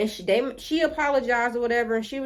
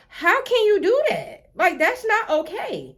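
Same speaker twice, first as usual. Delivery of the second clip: higher, drawn-out outrage; outraged; 8 semitones higher than usual. Real, not acted. Storyteller outraged at the teacher, slow emphatic disbelief. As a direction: stretch out the indignation.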